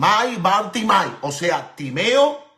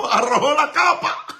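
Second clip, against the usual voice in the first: laughing; 6 semitones higher, 3 dB louder than usual. Real, not acted. Laughing through the line.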